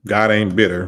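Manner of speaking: ordinary talk, nothing marked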